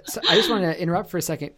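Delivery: fast, laughing apology; laughing as she apologizes for cutting in. Real, not acted. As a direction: giggle through the interruption. Host laughing apologizes for interrupting.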